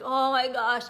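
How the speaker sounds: loud, appalled groan of words; retold dismay, blurted loudly; mock horror. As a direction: recoil aloud from what you just heard. Re-enacts hearing own recorded voice.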